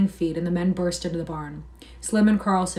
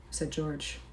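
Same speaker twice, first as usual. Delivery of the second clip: ordinary delivery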